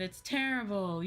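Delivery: ordinary talk, nothing marked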